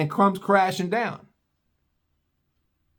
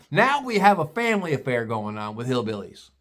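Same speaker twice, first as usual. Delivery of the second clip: usual speaking voice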